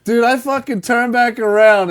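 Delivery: ordinary talk, nothing marked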